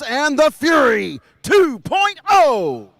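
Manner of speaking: slow, loud, stretched-out hype call; drawn-out announcer shout. Real, not acted. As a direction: stretch it out like a boxing-ring intro. Announcer introducing the aircraft.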